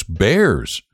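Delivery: plain reading voice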